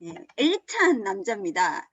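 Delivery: ordinary talk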